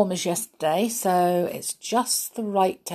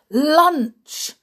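loud, higher excited call; cried out; 9 semitones higher, 6 dB louder than usual. Acted. Baloo lures the monkeys to the barrel, calling out loudly. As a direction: call the monkeys over with gusto. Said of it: "cried Baloo".

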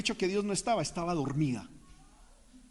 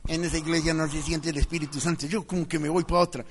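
lightly mimicked grumbler, lower voice; low, mildly dismissive imitation; mock complainer; 4 semitones lower than usual. Churchgoer saying he'll go to another church, mock complaint.

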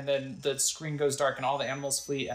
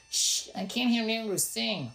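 put-on a light boy's voice, slowed; little boy; 8 semitones higher than usual. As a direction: hush them like an annoyed kid. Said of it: "Let's talk to the little boy".

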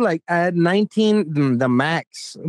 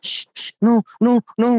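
higher-pitched mock "no, no"; imitated hushing; 6 semitones higher than usual. Chaperone silencing the protesting boy, mock hushing. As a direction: shush then refuse, in a put-on high voice.

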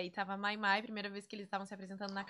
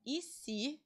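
suggestive what-if, slightly higher and softer; entonação de ideia repentina; 3 semitones higher, 5 dB quieter than usual. Retold or imitated. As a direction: float "E se?" as a teasing new idea. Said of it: "ela sabe até a entonação". (entonação de quem lança uma ideia)

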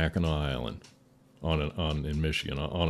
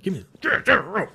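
high, louder retold command; mock-frantic, raised-voice order; urgent demand; 8 dB louder than usual. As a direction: act out a dad urgently demanding.

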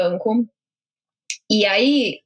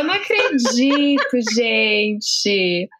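drawn-out words through laughter; laughing disbelief; much slower than usual. Real, not acted. Listener reacting to friend's embarrassing story, laughing in disbelief. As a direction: laugh helplessly while stretching the words.

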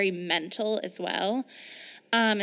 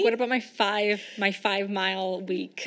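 mock indignant.

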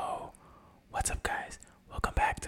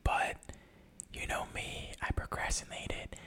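hushed whisper so neighbors sleep; breathy late-night whisper; 12 dB quieter than usual. Shown as a demonstration. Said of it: "this episode to be fully whispering".

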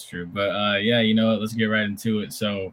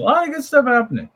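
much higher, louder cartoon voice; high squeaky voice; 8 dB louder than usual. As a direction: pop into a high silly voice. Sudden high put-on voice.

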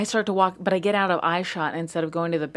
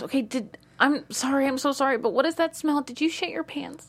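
high, hesitant, apologetic; nervous date asking an embarrassing question, high; awkward apologetic question; 7 semitones higher than usual. Retold or imitated. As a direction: ask it sheepishly, voice pitched up. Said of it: "He asked me with the word shit".

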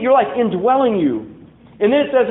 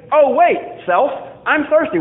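higher and louder, a mock eureka to yourself; sudden self-realization; 7 semitones higher, 4 dB louder than usual. Preacher acting out talking to himself, sudden realization. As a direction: catch yourself mid-thought, higher and louder.

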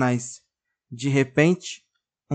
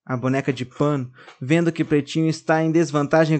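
usual speaking voice

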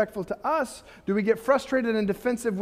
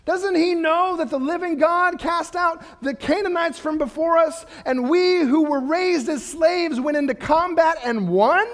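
high, loud, indignant outcry against the challenger; rising outrage, pressing each question harder; David fuming at Goliath's defiance of God; righteous indignation; 8 semitones higher, 4 dB louder than usual. Retold or imitated. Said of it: "He's got a righteous indignation".

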